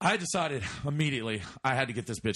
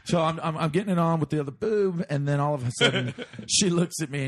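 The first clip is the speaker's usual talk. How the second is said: ordinary talk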